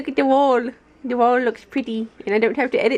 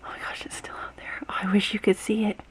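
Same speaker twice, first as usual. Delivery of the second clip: hushed excited whisper; whisper, trying not to scare it; 8 dB quieter than usual. Real, not acted. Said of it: "I'm afraid I'll scare it away".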